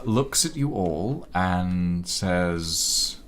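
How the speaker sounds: ordinary delivery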